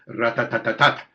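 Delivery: "rat-tat-tat-tat" in equal, evenly stressed beats; syllable-timed rhythm. Shown as a demonstration. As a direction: rap out "rat-tat-tat-tat", every syllable alike. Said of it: "syllable-timed rhythm".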